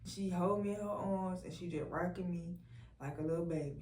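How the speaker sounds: ordinary talk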